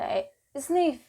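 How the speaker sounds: normal talking voice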